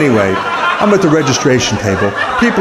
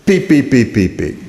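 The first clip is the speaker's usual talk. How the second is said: imitated detector beeps.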